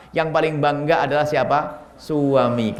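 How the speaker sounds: usual speaking voice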